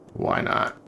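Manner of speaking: breathy whispered shrug; a throwaway whisper to yourself; whispered aside. Real, not acted. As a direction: toss it off under your breath. Shrugging aside while placing centers.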